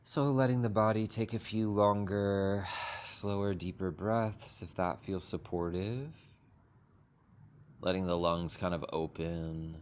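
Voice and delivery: unhurried, gentle meditation guidance with long pauses; guided meditation. Acted. Teacher guiding the group into a sitting meditation, calm and unhurried. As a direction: ease the group into stillness, one phrase at a time.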